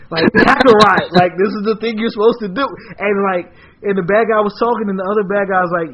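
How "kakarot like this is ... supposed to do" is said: loud, raised exasperated scolding; mock bickering, pitched up and loud; petty arguing. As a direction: scold a partner over a silly slip. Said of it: "petty arguing".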